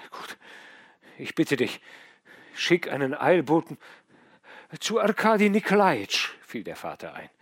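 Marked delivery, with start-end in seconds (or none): 0.1-6.3 louder and higher, pressing the father; a dying man summoning his last strength; urgent feverish plea. A narrator's reading. Dying son urging his father to send a messenger, loud and pressing.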